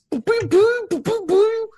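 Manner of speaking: high, loud mouth-made blaster sounds; phaser fire noises. Retold or imitated. Retelling Dukat blowing up the Klingon ship, with high loud blaster noises. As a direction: fire off the pew-pew like a kid playing spaceships. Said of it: "That's exactly kinda what it sounded like".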